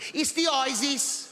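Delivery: slow, high Brummie "isis"; laughing Birmingham neighbour; Birmingham accent. Retold or imitated. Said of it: "She's from Birmingham".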